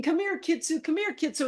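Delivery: high-pitched pleading call, no real crying; retold pleading. Owner chasing the dog in tears, imitated pleading. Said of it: "I'm crying and I'm pleading".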